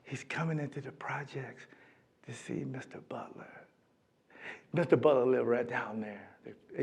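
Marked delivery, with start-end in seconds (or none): 0.0-3.5 awed hush. Retold or imitated. Awed hush about coach's visit.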